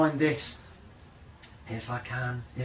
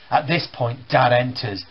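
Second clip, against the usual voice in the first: ordinary talk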